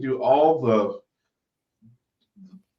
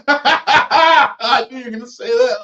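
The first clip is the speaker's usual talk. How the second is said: loud laughing through words; 11 semitones higher, 10 dB louder than usual. Real, not acted. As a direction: laugh out the reply. Co-host laughing at punchline.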